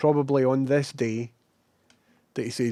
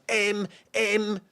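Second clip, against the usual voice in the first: higher, broad Scottish M; 9 semitones higher than usual. Retold or imitated. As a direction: say M the Scottish way, imitating Shawn Michaels. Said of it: "he says it in a pure Scottish voice".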